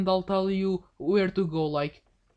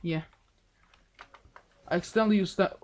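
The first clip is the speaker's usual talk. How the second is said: ordinary talk, nothing marked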